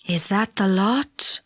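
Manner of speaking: slow, low, drowsy stranger; a lulling voice that weighs the children down; Sandman voice. A narrator's reading. Sandman speaks in the story.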